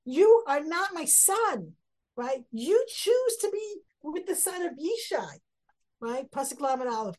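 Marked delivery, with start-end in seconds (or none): 0.1-1.7 slow, raised and higher; angry disowning. Retold or imitated. Father disowning his son, slow raised anger. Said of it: "is what he's saying". 2.5-5.4 angry rebuke. Retold or imitated. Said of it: "is what he's saying".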